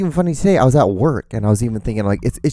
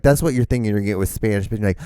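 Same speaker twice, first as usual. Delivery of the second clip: normal talking voice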